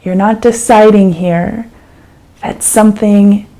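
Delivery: calm, unhurried meditation guide with long pauses; guided meditation. Acted. Teacher guiding a listener through an eyes-open meditation, calm and unhurried.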